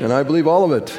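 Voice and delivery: loud, emphatic creed line; congregational declaration. Real, not acted. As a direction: proclaim it loudly for all to repeat. Leads congregation in repeated declaration.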